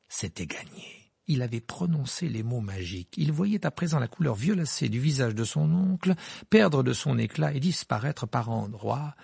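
ordinary delivery, nothing marked

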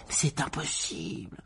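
slow frightened whisper. Acted. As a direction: breathe it out, scared. Harry refuses in fear.

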